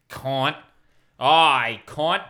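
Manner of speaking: loud, broad Aussie accent. Shown as a demonstration. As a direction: bark it like a rowdy Aussie. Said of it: "when you do an Aussie sort of impression".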